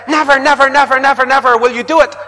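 loud, high, defiant never; dug-in refusal. Retold or imitated. An entrenched person vowing never, loud and high. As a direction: shout the never with heels dug in. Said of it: "you dig your heels in".

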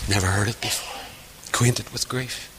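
very soft, low, solemn repeat; quiet reverence, voice falling away; hushed. Real, not acted. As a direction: drop almost to a whisper. Hushed repeats closing the sad anecdote.

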